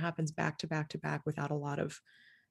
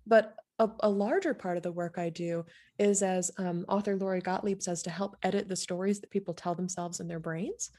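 ordinary delivery

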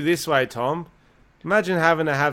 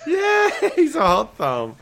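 laughing; 14 semitones higher, slower than usual. Real, not acted. Laughing at the hot thumb joke.

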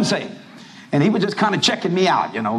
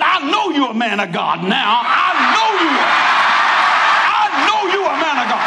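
higher, emphatic grateful husband; husband's praise; 6 semitones higher, 3 dB louder than usual. Retold or imitated. Husband tells the preacher, emphatic grateful praise. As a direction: insist warmly, half laughing. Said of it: "He said".

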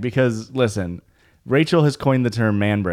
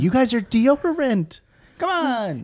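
mock coach voice; 11 semitones higher, slower than usual. Coaching friends in mock pep-talk.

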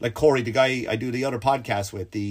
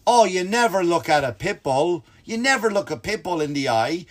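blaming; 5 semitones higher than usual. Retold or imitated. Corey blames visitor for looking at the dog, mock scolding. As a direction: stretch it out like a lecturing friend. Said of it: "like it's my fault".

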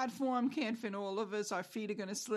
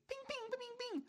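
pinball bing noises; 3 dB quieter, faster than usual. Retold or imitated. Emotions ricocheting off walls.

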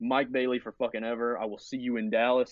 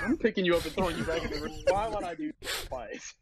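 fast then much higher disbelief; mock incredulous wrestler; 7 semitones higher than usual. Wrestler refusing the crowd's request, mock disbelief. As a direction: scoff like it's absurd. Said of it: "he's like".

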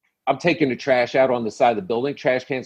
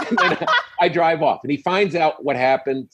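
usual speaking voice

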